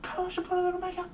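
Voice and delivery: squeaky high put-on voice, quick; silly high voice. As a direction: goof around in a squeaky high voice. Silly voice bit while painting.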